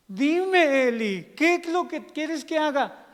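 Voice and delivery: high, loud, stretched reply; acted over-eager servant; Samuel. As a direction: jump up again to answer the call. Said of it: "como Samuel".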